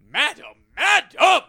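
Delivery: high, booming, drawn-out protest; flustered Merlin. Retold or imitated. Host imitating Merlin as his tail is grabbed, flustered shout. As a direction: cry out like an affronted old wizard. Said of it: "at one point he goes".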